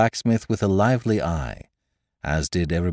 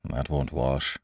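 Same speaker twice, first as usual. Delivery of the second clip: softer, lower private remark; aside; 4 semitones lower, 5 dB quieter, slower than usual. A narrator's reading. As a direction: murmur it aside to the priest. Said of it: "said the doctor aside".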